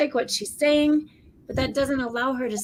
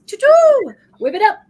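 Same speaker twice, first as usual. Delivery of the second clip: high, loud, sudden outburst; mock vocal tic; 10 semitones higher, 11 dB louder than usual. Co-host acting out tics from Tourette's, high mock outburst.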